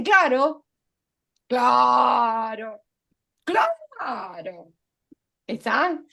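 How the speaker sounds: claro, claro, claro, first two loud, then easing off; mock filler habit. Shown as a demonstration. Teacher mimicking people who overuse claro, loudly. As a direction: parrot claro like a nervous tic. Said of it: "Son muletillas".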